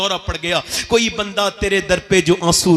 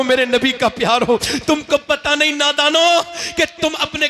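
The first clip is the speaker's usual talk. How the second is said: impassioned sermon peak; preaching at full force; 6 semitones higher than usual. Acted. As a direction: lift the crowd with fervent preaching. Preacher's climax to the youth.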